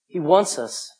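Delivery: usual speaking voice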